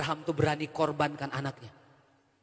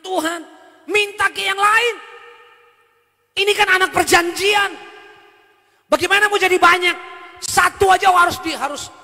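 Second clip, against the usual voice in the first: loud, higher pleading complaint to God; anguished protest; 12 semitones higher, 13 dB louder than usual. Retold or imitated. Abraham protesting to God over his son, loud and anguished. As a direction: argue with God as a torn father. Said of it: "Kalau saya jadi Abraham begitu pasti ngomongnya".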